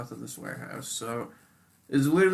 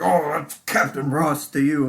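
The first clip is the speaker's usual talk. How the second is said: mock pompous officer, louder and drawn out; self-important cop; 2 semitones higher, 7 dB louder, slower than usual. Cop correcting a driver who greeted him, mock pompous. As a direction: pull rank loudly, imitating the cop. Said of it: "dude, this cop".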